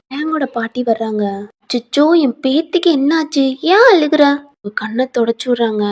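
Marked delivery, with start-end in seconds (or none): 1.6-4.2 fussing grandmother. Acted. Grandmother finds granddaughter crying.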